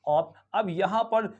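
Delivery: ordinary talk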